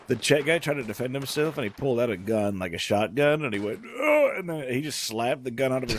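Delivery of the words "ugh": grunt. Retold or imitated. Gunman grunts when hit.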